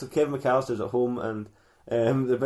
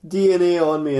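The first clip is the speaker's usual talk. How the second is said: higher, louder mock complaint; acted dismay; 6 semitones higher, 6 dB louder than usual. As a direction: protest like an aggrieved birthday boy. [name] protesting on his birthday.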